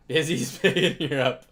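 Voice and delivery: reads it out while laughing. Real, not acted. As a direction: laugh through the comment. Reading a fun chat comment.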